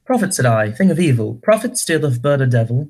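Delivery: verse in a put-on British accent; British-accented dramatic poem reading. Shown as a demonstration. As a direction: recite it with a fake British accent. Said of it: "read a scene with a British accent".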